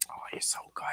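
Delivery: soft whisper, not to wake her. Real, not acted. Owner whispering praise to her dozing dog.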